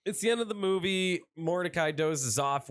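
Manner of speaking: ordinary delivery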